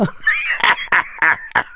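high breathy demonic cackle; evil laugh. Acted. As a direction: cackle like a gloating fiend. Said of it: "lança la voix infernale".

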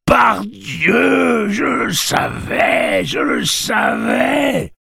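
high, loud, jubilant shouting; overexcited, drawn-out cry; trembling with excitement. A narrator's reading. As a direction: shout it in triumph, stretching the words. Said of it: "d'une voix que l'excitation faisait trembler".

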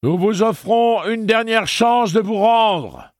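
loud, slow megaphone call; police calling from the street; amplified voice. A narrator's reading. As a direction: announce it through a loudspeaker like a game host. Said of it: "fit une voix amplifiée".

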